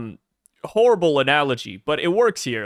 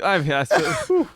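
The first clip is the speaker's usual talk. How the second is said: laughing excuse; 4 semitones higher, much slower than usual. Retold or imitated. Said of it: "husband laughing through excuse".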